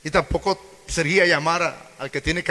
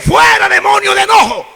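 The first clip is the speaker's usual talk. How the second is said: very high, loud hammed-up casting out; mock exorcist shout; 13 dB louder than usual. Preacher mimics another preacher rebuking a demon, in a loud high mock shout. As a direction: yell it like an overzealous exorcist. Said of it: "y decía".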